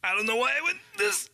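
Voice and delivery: put-on a botched stab at a Haitian accent, pitched high; high, wobbly attempt at a Haitian accent; failed Haitian accent. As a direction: try a Haitian accent and lose it. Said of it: "I don't know how to do a Haitian accent".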